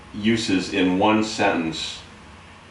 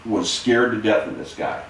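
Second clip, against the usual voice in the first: usual speaking voice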